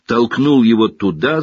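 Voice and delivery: ordinary delivery, nothing marked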